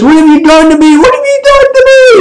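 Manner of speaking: very high, wailing mock agony; hammed-up anguish. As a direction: wail it like someone being burned alive, playfully overdone. Acts burning Brock's anguished cry.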